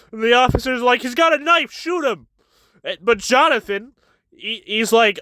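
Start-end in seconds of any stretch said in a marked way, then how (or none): 1.0-2.3 higher, urgent mock police shout; officer yelling. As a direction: bark the order like a panicked cop.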